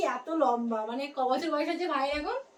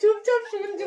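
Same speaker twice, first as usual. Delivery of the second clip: laughing; 7 semitones higher, 5 dB louder than usual. Real, not acted. Laughing at the quiet boy.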